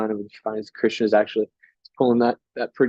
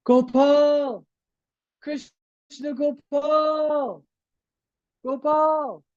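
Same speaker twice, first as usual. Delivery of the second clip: high, stretched-out calls, held back in volume; drawn-out yelling; much slower than usual. Acted. Thief searching day and night for Krishna, yelling. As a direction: call out the name on a long, high, sustained note. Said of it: "he starts yelling".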